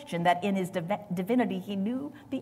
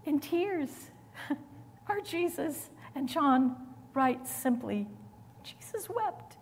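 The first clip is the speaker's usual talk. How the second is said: higher and softer, close to tears; voice breaking; 6 semitones higher, 6 dB quieter than usual. Real, not acted. Preacher moved to tears quoting Jesus wept, voice breaking. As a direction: let your voice crack on it.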